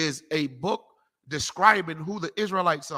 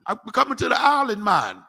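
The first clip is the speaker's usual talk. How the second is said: higher, louder put-on Jamaican accent, hammed up; mocking outsiders faking a Jamaican accent, loud and high; 7 semitones higher, 5 dB louder than usual. Preacher mocks oppressors faking Jamaican, in a loud high mock Jamaican accent. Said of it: "try to sound like the Jamaicans".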